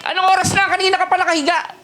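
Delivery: high, fast mock scolding; yelling parent. Preacher imitates a mother scolding a child for oversleeping, high and fast. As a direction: nag the kid still lying in bed. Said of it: "Eh, bakit?".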